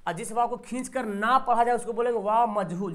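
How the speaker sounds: ordinary delivery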